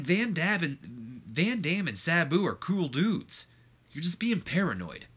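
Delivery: high, exasperated protest; exasperated brother. Retold or imitated. Jeff snapping back at his brother Matt, exasperated. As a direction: push back hard at your brother. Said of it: "he goes".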